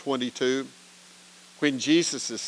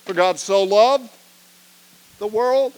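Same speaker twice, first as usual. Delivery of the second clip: prompting start; 6 semitones higher, 7 dB louder than usual. Shown as a demonstration. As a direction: call out the opening so they finish it. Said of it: "I would start with".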